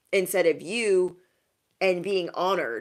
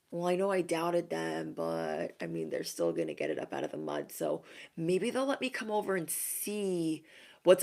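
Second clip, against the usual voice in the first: sheepish angling; 6 dB quieter than usual. Acted. Doubters hoping to come over and see, sheepish. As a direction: mumble a half-hearted concession, angling for entry.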